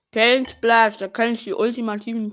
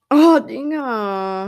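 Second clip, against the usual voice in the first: drawn-out surprised oh; 3 semitones higher, 11 dB louder, much slower than usual. Real, not acted. Reacting to game moment.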